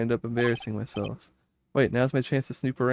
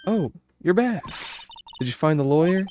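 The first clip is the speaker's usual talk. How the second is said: put-on cheerful young girl; 5 semitones higher than usual.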